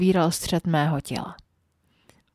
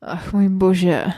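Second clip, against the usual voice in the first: drawn-out moan; 5 dB louder, much slower than usual. Acted. Heroine moaning in pleasure.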